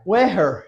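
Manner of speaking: "Weher" with a breathy h where the th goes; "Weher", the th replaced by a plain h sound; wrong th as h. Shown as a demonstration. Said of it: "some of the ways to not say it".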